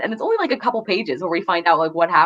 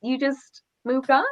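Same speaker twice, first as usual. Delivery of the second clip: sarcastic reaction; 7 semitones higher, slower than usual. Retold or imitated. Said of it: "where I'm like".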